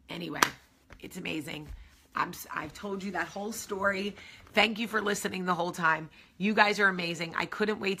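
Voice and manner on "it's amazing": normal talking voice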